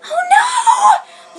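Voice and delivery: very high, loud cry with a sob; scream. Acted. Lettuce, hearing Pudding will be Ciel's bride, screams. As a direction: shriek it in despair. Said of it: "Lettuce screamed".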